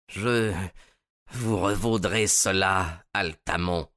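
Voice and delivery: slow, deliberate vow of revenge; measured, resolute threat; resolute slowness. A narrator's reading. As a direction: draw it out with resolve. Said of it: "avec une lenteur résolue".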